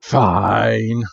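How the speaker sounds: detective agrees grudgingly before hanging up. Acted. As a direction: give in with bad grace. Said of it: "puts the phone down very grudgingly".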